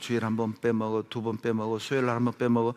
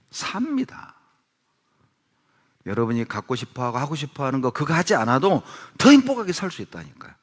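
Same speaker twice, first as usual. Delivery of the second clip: ordinary delivery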